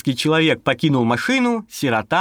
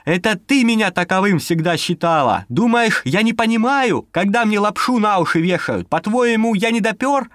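heated; 6 semitones higher than usual. Acted. Continued quarrel.

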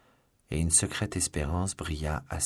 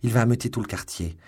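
raised, louder worried exclamation; exclaimed; 5 semitones higher, 5 dB louder than usual. A narrator's reading. Wife fearing the neighbourhood will be roused, exclaimed. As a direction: exclaim it in alarm. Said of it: "s'écria madame Bonacieux".

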